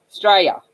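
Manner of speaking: "Australia" shortened to Straya, first syllable dropped; clipped local pronunciation. Shown as a demonstration. Teaching viewers the local word, "Australia" said as Straya. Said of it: "That's how we say it".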